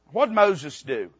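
ordinary talk, nothing marked

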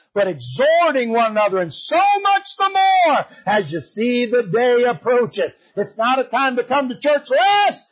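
high, stretched, building preacher's cry; full-force preaching. Acted. Preacher urging the church to gather, full-force preaching.

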